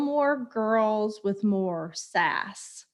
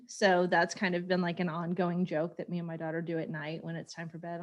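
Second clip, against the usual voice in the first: usual speaking voice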